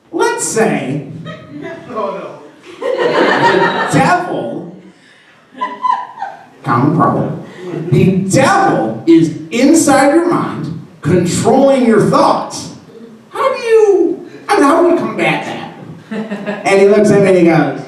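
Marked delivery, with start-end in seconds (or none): 0.1-4.8 high, slow and halting; hesitant question. Retold or imitated. Parishioner sounding out a priest, hesitant and high-pitched. 7.9-16.7 high, drawn-out hypothetical; hesitant question. Retold or imitated. Parishioner asking the priest for help, awkwardly tentative.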